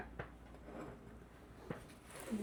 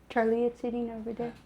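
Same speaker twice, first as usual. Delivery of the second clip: ordinary delivery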